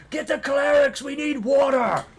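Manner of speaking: high, loud frantic yelling; workers shouting. Acted. Workers calling for water at the fire, loud frantic shout. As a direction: bellow for help across the yard. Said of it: "many of them are, like, shouting".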